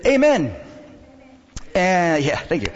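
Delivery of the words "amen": much higher, louder imitated outburst; shouted amen. Lady hears something wonderful from the pastor, shouting out. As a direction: burst out with an amen mid-sermon. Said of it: "and she said".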